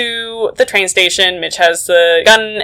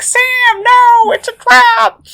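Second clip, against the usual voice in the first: much higher, slow dramatic cry; mock warning cry; slower than usual. Mitch and the agent crying out to warn Sam, hammed-up cry. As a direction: call out as if in a melodrama.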